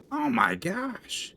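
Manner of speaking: high, slow, drawn-out gasp of disbelief; stunned. Real, not acted. Player stunned by an enemy that won't die. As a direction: let the oh stretch up in shock.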